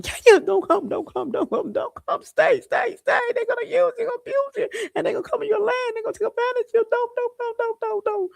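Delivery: piercing falsetto pleading, words tumbling in repeats; mock frantic warning. Acting out people warning Kenya off, mock frantic falsetto. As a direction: squeal it out like a panicked alarm, hammed-up.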